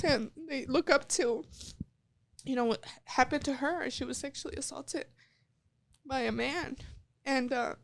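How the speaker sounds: high, soft, crying voice fighting tears; tearful. Real, not acted. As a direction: let the tears come through the words, soft and high.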